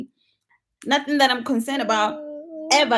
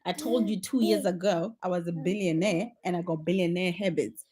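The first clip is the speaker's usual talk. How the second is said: ordinary talk, nothing marked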